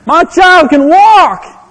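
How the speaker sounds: high, loud, slow cheer of a proud parent; joyful shout. Retold or imitated. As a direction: shout it out like your baby just walked. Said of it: "and you go".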